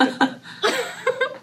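helpless, very high cackle; high-pitched laugh. Real, not acted. Daughter cracking up over mom's request, high laughter. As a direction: crack up completely.

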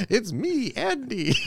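very high cartoon catchphrase; Mario-style self-announcement. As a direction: announce yourself in a high game-hero voice. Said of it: "stepping out of your house and being like".

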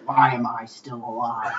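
put-on low, soft weary old lady; a quiet, world-weary grumble; grumpy old lady. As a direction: sigh it like a tired old woman. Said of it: "She was like".

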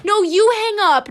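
high, loud teasing protest; playful mock protest. Host pretending a lovey phone goodbye, playful high protest.

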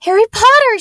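very high, loud excited squeal. A narrator's reading. Young girl spotting a famous boy, squealing. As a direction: squeal like a thrilled little girl. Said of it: "she squealed".

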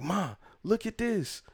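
high, drawn-out call to mom; awestruck kid. Retold or imitated. Kid calling mom over to the game, awestruck. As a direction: imitate an awed kid, high and slow. Child urging mom to look, retold.

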